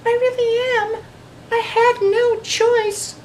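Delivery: very high, small creature voice; tiny, sheepish apology; little voice. A narrator's reading. Snatch-a-Book to Eliza, tiny high voice. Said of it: "came a little voice".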